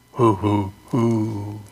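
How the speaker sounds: low hooting owl call. Pretend. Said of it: "Aren't I good?".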